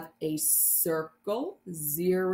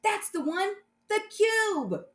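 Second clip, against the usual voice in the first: excited; 12 semitones higher than usual. Acted. Excited answer in story.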